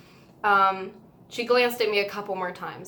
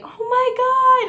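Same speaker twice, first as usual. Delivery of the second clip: high, loud squeal of surprise; imitating an amazed crowd shriek; shrieked amazement; 9 dB louder than usual. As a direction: squeal it like a stunned fan in the crowd. Crowd reacting around her.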